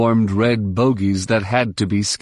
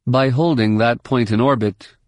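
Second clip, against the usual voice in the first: ordinary delivery, nothing marked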